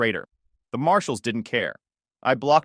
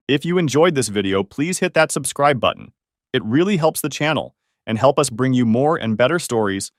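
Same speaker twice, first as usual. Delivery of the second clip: louder, upbeat channel sign-off; energetic YouTube outro; outro pitch; 8 dB louder than usual. Acted. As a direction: sell the subscribe button. Channel outro call to subscribe.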